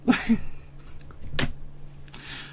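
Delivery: airy unvoiced giggle fit; breathless silent laugh. Real, not acted. Streamer wheezing with laughter at his own move. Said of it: "I don't know why I'm laughing".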